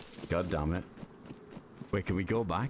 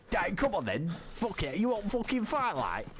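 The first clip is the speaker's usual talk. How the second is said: higher mock British lad taunt; fake British accent; 6 semitones higher than usual. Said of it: "Talking about accents".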